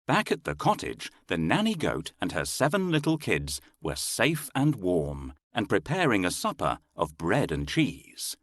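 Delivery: ordinary delivery, nothing marked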